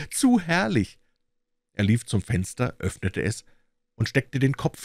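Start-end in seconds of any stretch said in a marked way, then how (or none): 0.1-1.0 high-pitched, swooping exclamation of delight; entzückt. A narrator's reading. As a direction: repeat it much higher, overcome with joy. Entzückter Ausruf, gesteigerte Wiederholung. (entzückt und hoch ausgerufen)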